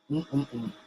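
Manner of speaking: usual speaking voice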